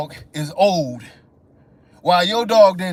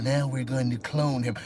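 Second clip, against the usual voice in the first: low, quiet mocking voice; mock breeder; 8 semitones lower, 8 dB quieter than usual. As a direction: sneer it like the breeder.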